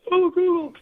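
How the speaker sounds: high squealing American girl imitation; mock swooning girl, way up high and loud. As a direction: squeal it up high like a smitten fan. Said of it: "they'd all go".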